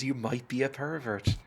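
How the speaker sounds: ordinary talk, nothing marked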